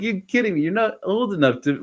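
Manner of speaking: high, amazed protest; you can't believe it; incredulous. Retold or imitated. Disbelief at his calling.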